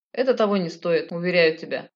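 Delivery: ordinary delivery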